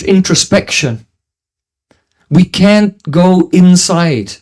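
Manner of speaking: ordinary delivery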